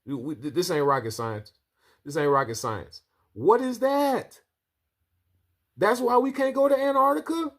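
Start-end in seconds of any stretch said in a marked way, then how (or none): 3.3-7.5 much higher, stunned outcry; shocked. Real, not acted. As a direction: gasp it out in disbelief. Reacting to strange satellite picture.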